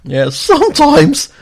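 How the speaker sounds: very high, slow cartoon mimicry; imitating a cartoon voice. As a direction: echo it back squeaky and drawn out. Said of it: "You just sound like a cartoon character".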